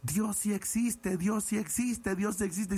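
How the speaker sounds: high, slow ecstatic cries to heaven; overjoyed beggar shouting to the sky; shouting like a madman. Retold or imitated. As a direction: cry it out like a man gone half mad with joy. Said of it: "empieza a gritar como loquito".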